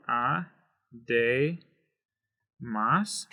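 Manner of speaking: "a, de, mas," as three separate syllables with pauses; broken into syllables. Shown as a demonstration. Teacher breaking the word apart, a, de, mas spaced out. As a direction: leave a gap after each of a, de, mas. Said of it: "break it apart".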